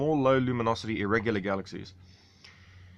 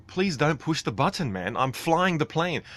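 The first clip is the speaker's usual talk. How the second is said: pleading; 6 semitones higher than usual. Retold or imitated. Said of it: "I'm like".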